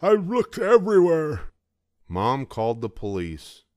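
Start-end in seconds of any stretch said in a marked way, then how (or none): 0.0-1.5 put-on high, loud gummy old man near tears; tearful grandpa; tearful toothless grandpa. A narrator's reading. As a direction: answer like a grandpa about to cry. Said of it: "with tears in his eyes".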